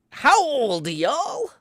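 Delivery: "howl" drawn out high and loud like a wolf; howled wordplay. Pretend. Trying a viewer's suggested catchphrase, howling it playfully. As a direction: howl the words, rising at the end. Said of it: "that's pretty good".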